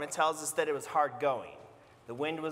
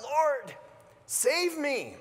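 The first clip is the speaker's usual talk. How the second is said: much higher, louder desperate plea; hammed-up panicked Lord save me; mock cry for help; 12 semitones higher, 4 dB louder, much slower than usual. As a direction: cry out while sinking. Peter sinking, crying out.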